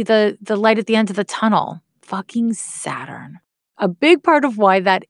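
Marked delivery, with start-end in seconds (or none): 2.0-3.4 soft, slow, exasperated curse under the breath; a half-joking sigh of blame; muttered curse. Real, not acted.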